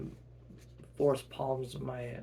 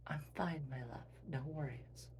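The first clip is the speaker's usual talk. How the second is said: very soft, near-whispered comfort; hushed and tender; soft reassurance; 11 dB quieter than usual. Acted. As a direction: reassure a loved one, barely audible. Quinn soothing a worried partner.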